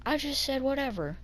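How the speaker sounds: soft, high retold reply; meek defence. Kid answering angry bus driver, meek retold defence.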